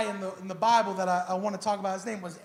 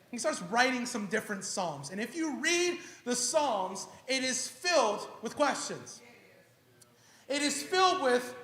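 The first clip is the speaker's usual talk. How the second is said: normal talking voice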